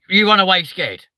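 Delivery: ordinary delivery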